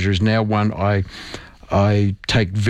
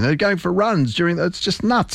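higher-pitched exasperated aside; exasperated disbelief; 8 semitones higher than usual. Real, not acted. Doctor scoffing at people jogging on polluted days, exasperated. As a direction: let real incredulity push the pitch up.

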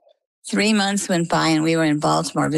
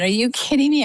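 high-pitched retold disbelief; incredulous; 9 semitones higher than usual. Patient reacts to losing meat for good, retold incredulously. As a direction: pitch jumps up in mock-outraged protest.

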